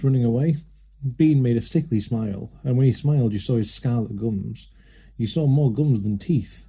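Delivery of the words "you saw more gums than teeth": usual speaking voice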